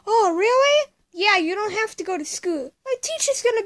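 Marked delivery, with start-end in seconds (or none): 0.1-0.9 high, loud gasp of joy; delighted surprise. Acted. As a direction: squeal with surprise.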